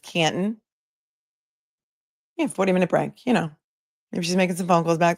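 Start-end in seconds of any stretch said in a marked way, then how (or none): 0.0-0.6 Canton said CAN'n, T dropped, lifting at the end; swallowed T. Shown as a demonstration. Host explains the local way, Canton without its T. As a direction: let the T in Canton vanish. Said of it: "You don't pronounce the T".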